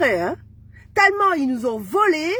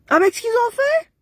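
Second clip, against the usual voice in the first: outraged; 10 semitones higher than usual. Real, not acted. Woman on her live repeating her accusation in outrage. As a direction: cry it out in outrage.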